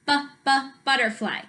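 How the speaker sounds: "B, b" as short separate sounds before "butterfly"; phonics-style "B, b" leading into "butterfly"; sounding out. Shown as a demonstration. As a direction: isolate the first sound, "B, b", then say "butterfly". Sounding out first letter.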